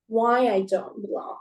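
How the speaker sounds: ordinary talk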